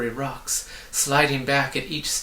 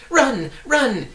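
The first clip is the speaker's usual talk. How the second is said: much louder, much higher urgent cry; 13 semitones higher, 8 dB louder than usual. A narrator's reading. A hidden voice urging the travellers to flee, crying out. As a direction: yell a warning from afar. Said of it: "it shouted again".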